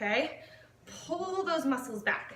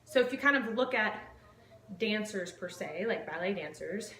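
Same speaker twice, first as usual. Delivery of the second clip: ordinary talk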